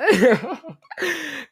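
high, loud burst of laughter. Real, not acted. Podcaster catching her catchphrase, loud high laugh.

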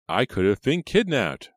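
high, loud self-centred protest; interrupting. A narrator's reading. Reva cuts off her caller, interrupting indignantly. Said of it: "Reva interrupted".

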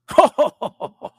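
loud laugh cracking up; bursting laugh at own bit; high burst of laughter. Real, not acted. Laughs after own impression.

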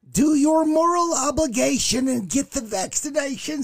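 high mocking imitation of a preachy older lady. Older lady in a video lecturing viewers, high mock imitation. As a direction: ham up an older woman lecturing everyone, pitched high. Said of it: "She's like".

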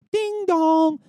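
high, held sing-song ding dong; imitated cabin chime. Comic mimicking turbulence chaos on a plane, high chime imitation. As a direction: chime out loud like a seatbelt alert.

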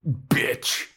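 high-pitched comic cursing; playful imitation of a sudden outburst; mock insult outburst. Acting out Tara's sudden insult.

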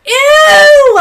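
high loud disgusted shriek. Real, not acted. Disgusted reaction to pig creature.

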